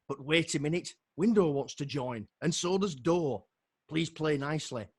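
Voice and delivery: lively rhyming poem read with punch; rhythmic spoken-word delivery; poem recital. Acted. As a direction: perform the verse with comic drive. Reads his own comic poem.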